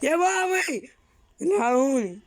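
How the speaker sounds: high, panicked call for daddy; mock-panicked plea for help; crying for help. Real, not acted. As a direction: cry out for rescue. Calling for help, scared.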